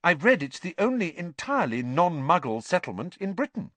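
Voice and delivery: high-pitched eager recital; lightly voiced keen know-it-all. A narrator's reading. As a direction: share the fact keenly. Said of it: "asked Hermione keenly".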